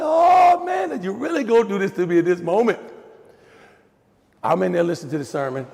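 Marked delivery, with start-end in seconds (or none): 0.0-2.8 mock exasperation. Reaction to sermon on forgiveness.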